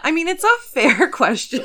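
loud laughing. Real, not acted. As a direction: say it through laughter. Laughing along.

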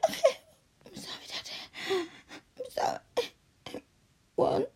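ordinary talk

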